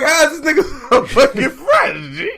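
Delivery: high, incredulous question broken by laughter; laughing disbelief. Retold or imitated. Host retelling his reaction to fresh clothes, laughing disbelief. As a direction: crack up in mock amazement.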